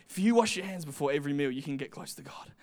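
lightly mimicked priests, low and quick; imitated priests. Voices the priests' rule-keeping logic.

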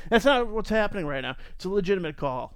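ordinary talk, nothing marked

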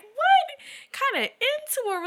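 slow, squeaky-high retold outrage; high-pitched disbelief. Telling how they reacted to the breakup, high-pitched disbelief.

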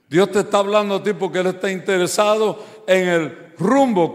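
fervent preacher pounding each phrase; full-force preaching. Acted. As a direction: preach it hard to the congregation.

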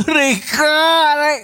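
put-on high, far-off yelp like a smacked kid; hammed-up ouch of a hit child; mock yelp of pain. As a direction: cry out as if just spanked. Mock child crying out when hit.

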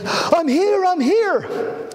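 very high-pitched, hurried announcement; imitated urgent calling out. Priest running in late, high urgent call. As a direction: mimic someone bursting in and calling out.

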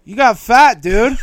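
mock a high guy blurting it out; stoner Spicoli voice. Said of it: "Like he's Spicoli".

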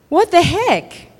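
mock-stunned, drawn-out disbelief; lightly acted amazement, stretched out; imitated astonishment. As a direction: play a baffled onlooker, slowly. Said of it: "caused the unbelievers to be like".

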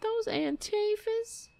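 high, slow sarcastic mock fright; mock scared voice. Mocking the politician, high sarcastic fake fear. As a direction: sneer at her fear in a high voice.

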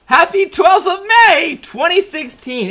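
high, loud radio-host cheer; cheery announcer. Acted. Podcast host cheerfully greeting listeners with the date.

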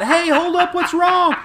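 high, loud calling-out; mock concerned shout. Man stopping a fleeing woman, mock concerned shout. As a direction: holler it up high, half laughing.

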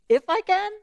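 you doubt that I can?; incredulous. Acted. Jesus answering the doubting father, high and incredulous.

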